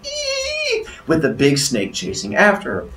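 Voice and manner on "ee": thin, very high squeal, held back; long, soft mock shriek; mock scream. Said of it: "takes off running and screaming".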